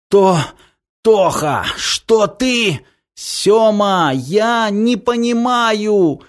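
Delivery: high, halting, distressed cry; anguished confusion. Acted. Brothers amid the fight, anguished confusion. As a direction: stammer the name, then wail in confusion.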